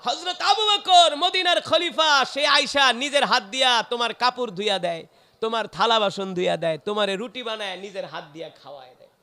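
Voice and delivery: loud, high outburst easing down; full-force preaching. Acted. Omar telling the blind one who served them, impassioned preaching. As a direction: hit the name high, then gradually descend.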